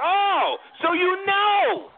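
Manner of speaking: high, slow, pointed "so you know"; mock knowing teacher. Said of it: "She's like".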